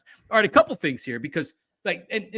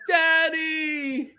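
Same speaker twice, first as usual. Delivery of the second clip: put-on high, drawn-out kid's call; imitated child; 13 semitones higher than usual. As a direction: call out like a child wanting a parent. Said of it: "The kids are like".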